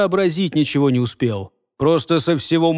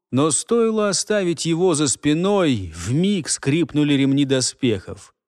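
plain reading voice

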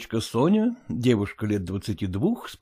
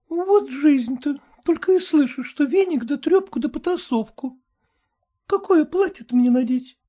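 put-on high, cheeky girl's voice; high mocking daughter; pert young daughter. A narrator's reading. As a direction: tease the mother lightly.

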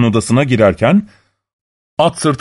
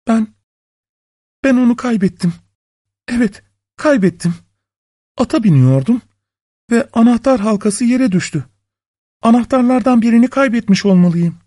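high, hesitant woman's voice; nervous wife; 10 semitones higher than usual. A narrator's reading. Wife lying about the lost key, high and flustered. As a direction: stumble into an excuse, pitched high.

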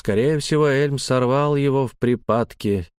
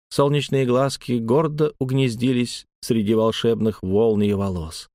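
usual speaking voice

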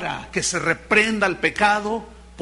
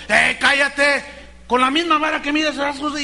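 raised, higher scolding voice; mock silencing; 5 semitones higher, 3 dB louder than usual. People silencing whoever dares speak up, mock scolding. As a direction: shut someone down loudly, mimicking.

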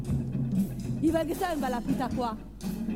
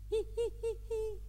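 high, soft "hi hi hi"; short high-pitched repeated squeals; imitated high cries; 8 semitones higher, 7 dB quieter than usual. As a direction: mimic a thin, piping sound. Storyteller imitates sound she heard.

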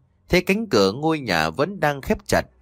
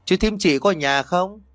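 higher-pitched call at the gate; lightly raised calling voice; 7 semitones higher than usual. A narrator's reading. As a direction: call out to see if anyone is home. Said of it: "cất tiếng gọi".

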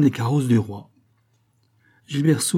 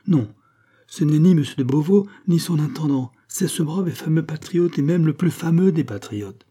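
raised, high-pitched proclamation; crying out; 6 semitones higher, 4 dB louder than usual. A narrator's reading. A burly farmer sets the crowd right about Gilbert, crying out. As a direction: proclaim it to everyone around. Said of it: "cria une grosse voix".

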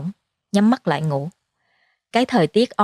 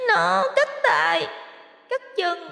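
put-on very high, tearful ghost-baby lament; sobbing high-pitched plea to live; wailing monster child; 11 semitones higher, 4 dB quieter, slower than usual. A narrator's reading. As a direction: draw out the words in childlike agony. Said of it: "liên tục gào lên".

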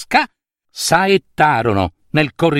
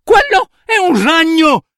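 terrore urlato; 13 semitones higher, 4 dB louder than usual. Acted. Nutria terrorizzata vede il ragno.

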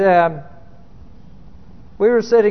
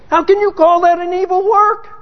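indignant question; 8 semitones higher than usual. Retold or imitated. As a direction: challenge God, affronted, ending on work. Repeated indignant question, escalating.

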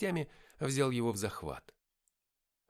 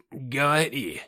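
loud, higher, strained command; forced, scornful outburst; hissed demand; 4 semitones higher, 10 dB louder, much slower than usual. A narrator's reading. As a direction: spit the order out with contempt. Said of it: "прошипел Зефон".

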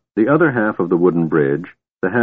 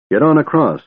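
sharp higher order; commanding; 6 semitones higher than usual. Acted. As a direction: bark the order, raised. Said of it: "Tanis ordered Tika".

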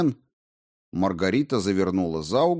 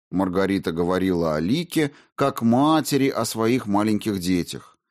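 ordinary delivery

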